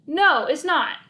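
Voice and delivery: firm no. A narrator's reading. Malcolm flatly rejecting idea.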